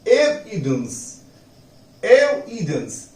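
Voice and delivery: Evidence slow, first syllable E punched; stress on E. Shown as a demonstration. Teacher drilling the stress for students, slow. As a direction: hit the E of evidence, slowly. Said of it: "ए पर जोर लगाई".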